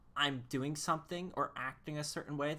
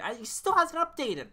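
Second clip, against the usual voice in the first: higher and louder exasperation; exasperated; 11 semitones higher, 10 dB louder than usual. Real, not acted. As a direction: complain loudly, worked up. Rant about Preston.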